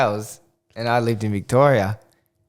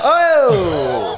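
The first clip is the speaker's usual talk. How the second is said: surprised; 6 semitones higher, 4 dB louder than usual. Real, not acted. Surprise at the reading.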